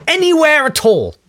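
high, squeaky with glee; excited. Real, not acted. Podcaster just out of quarantine, excited and high. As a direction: burst out high with joy.

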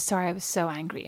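soft, low mild apology; zen apology. Retold or imitated. Zen friend apologising for her anger, soft and mild. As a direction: imitate a serene friend, voice dropped. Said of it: "so zen".

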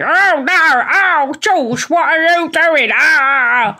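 high, drawn-out mum turning into a puppet; Zippy. Acted. Daughter mimics mum blending into Zippy, high silly voice. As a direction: let a fussing mother slide into a children's puppet voice. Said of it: "my mum blends into Zippy".

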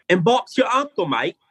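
mock British accent pitched high on the first words; a playful stab at a British accent, quoting a friend. Guest shows the hosts his shaky mock British accent. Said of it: "Now we want to hear your British accent".

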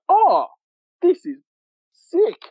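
high-pitched, drawn-out awed reaction; replayed thrilled realisation; re-enacted amazement. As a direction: relive being blown away, pitch leaping up on key words. Acting out his amazed reaction.

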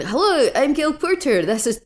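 high, hammed-up mock Scottish accent; badly imitated Scottish accent. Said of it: "she does my s-, accent really badly".

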